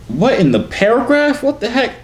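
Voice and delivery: high, loud disbelief; shocked disbelief. Real, not acted. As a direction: react in total disbelief. Shocked reading a message.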